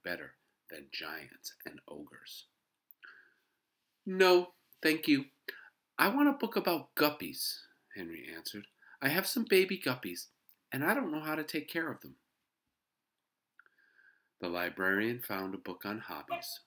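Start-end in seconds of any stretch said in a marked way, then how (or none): 4.1-7.7 put-on high, loud kid voice; earnest boy. A narrator's reading. Boy answers the librarian. 9.0-12.2 put-on high, loud kid voice; earnest boy. A narrator's reading. Boy explains to librarian.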